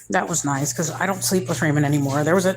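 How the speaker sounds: ordinary talk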